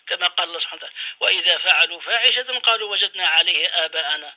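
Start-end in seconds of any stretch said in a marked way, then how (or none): 1.2-4.3 Quranic recitation. Acted. Recites the Quran verse in full.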